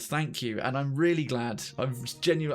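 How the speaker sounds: usual speaking voice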